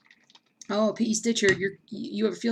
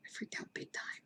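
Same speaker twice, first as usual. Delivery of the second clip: whispered confession; whispering to viewers why she freaked out; 18 dB quieter than usual. Real, not acted. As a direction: hush it like a secret. Drops to a whisper.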